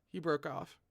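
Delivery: ordinary delivery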